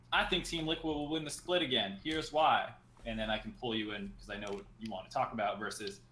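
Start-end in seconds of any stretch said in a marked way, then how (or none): 0.1-2.7 higher, assertive example take; confident caller take. Shown as a demonstration. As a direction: pitch it like a sure opinion. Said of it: "we prefer if people say".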